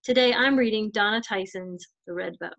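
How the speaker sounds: ordinary delivery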